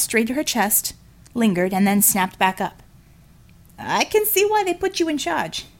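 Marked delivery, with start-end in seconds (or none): 3.8-5.7 lightly voiced heavy-set American worker; a hint of a blunt construction man; easygoing American workman, mildly teasing; gruff union man. A narrator's reading. Hensel leering.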